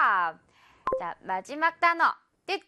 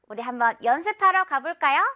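ordinary delivery

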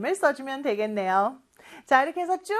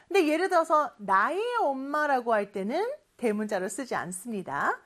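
usual speaking voice

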